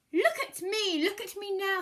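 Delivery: loud, high boasting call; showing-off cat. Acted. Cat boasting to the children, high excited call. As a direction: crow for attention.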